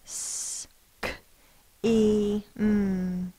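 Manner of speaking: segmenting the word sound by sound. Shown as a demonstration. Teacher to class, sounding out each sound. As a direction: stretch each sound of scheme apart. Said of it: "let's sound out our word scheme".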